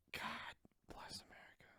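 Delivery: breathy mock-solemn whisper. Whispering a mock blessing over her screen time. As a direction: murmur it under the breath like a hushed blessing.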